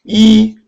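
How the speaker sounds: 이 held high, loud and level; first-tone demo. Shown as a demonstration. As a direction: sustain 이 on one high pitch. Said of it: "일 성입니다".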